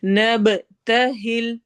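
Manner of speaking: نَبْتَهِلْ with a bouncing echo on the b; نَبْتَهِلْ, b released with qalqala echo. Shown as a demonstration. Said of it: "با پہ قلقلہ ہوگا".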